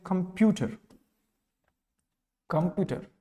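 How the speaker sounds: Computer as "com-PYU-ter", middle syllable stressed; stress on second syllable. Shown as a demonstration. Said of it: "इसमें प्यू जो है". (Computer के "प्यू" पर ज़ोर)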